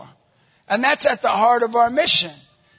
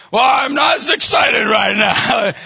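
slow, loud mock annoyance; fed-up wife; 3 semitones higher, 7 dB louder, slower than usual. Wife on her fourth message to husband, pointedly unexcited. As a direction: drag it out like a let-down caller.

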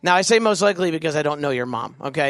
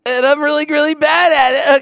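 mock wailing; 11 semitones higher, 8 dB louder than usual. Kid confessing failure to mom, wailing theatrically. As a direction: bawl it out in hammed-up despair.

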